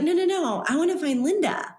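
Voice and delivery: high-pitched eager no no no; eager insistence. Retold or imitated. Insisting on finding Linda.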